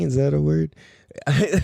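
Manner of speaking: ordinary delivery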